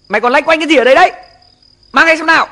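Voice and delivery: put-on fast, loud, high scolding of a child; angry scolding. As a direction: snap at the kid to hand over the notebook. Father scolding child over homework. (quát con gắt gỏng)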